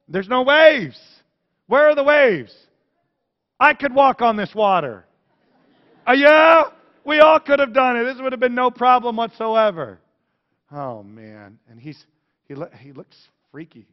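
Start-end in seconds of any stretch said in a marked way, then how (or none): 0.1-10.0 loud, high scoffing at the calm sea; mock it like anyone could do this. Real, not acted. Vlogger scoffing at the painting's calm water, loud and high.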